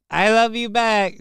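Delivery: lightly imitated clip line; mild imitation of the clip. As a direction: parrot the clip dryly. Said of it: "doing a cringe accent".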